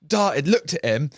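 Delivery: ordinary talk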